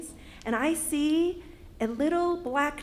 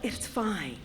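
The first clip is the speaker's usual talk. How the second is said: soft, low brush-off; calm yourself down quietly; quiet, settling "no problem"; self-reassurance; 4 semitones lower, 8 dB quieter than usual. Acted. Reassuring herself before the greeting.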